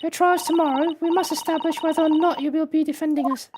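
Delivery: put-on high, polite young woman voice; young lady voice. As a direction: speak as a prim, proper young assistant. Voices Susato character.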